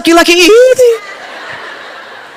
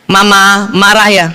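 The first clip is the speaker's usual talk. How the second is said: slow, low, measured question; lightly voiced husband checking carefully; gently asking husband; 8 semitones lower, slower than usual. Husband checking on his wife, slow and careful.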